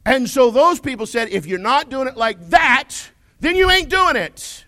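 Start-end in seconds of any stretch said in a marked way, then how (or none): 1.3-4.6 raised, higher voice laying down the rule; loud mock ultimatum, punched high at the end; insistent. Preacher quoting door-knocking zealots to his congregation, loud and insistent.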